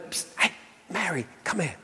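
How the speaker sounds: whispered psst to a sister; a whispered call from the kitchen; hushed call. Retold or imitated. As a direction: call her over under your breath. Martha quietly calling her sister.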